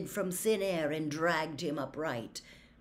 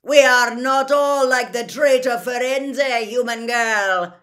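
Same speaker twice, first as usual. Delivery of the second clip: very loud, raised, scornful centaur voice; angry shout; 7 semitones higher, 15 dB louder than usual. A narrator's reading. Gray centaur rebuffs Hermione, shouting angrily. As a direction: bellow it with scorn. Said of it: "shouted the gray centaur".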